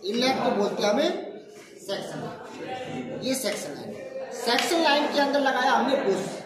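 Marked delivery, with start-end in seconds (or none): none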